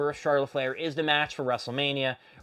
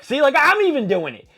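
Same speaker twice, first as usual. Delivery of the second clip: loud, high-pitched imitated protest; 11 semitones higher, 10 dB louder than usual. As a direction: blurt it out loudly, playing the wrestler. Voicing wrestler's loud protest.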